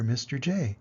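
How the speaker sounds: ordinary delivery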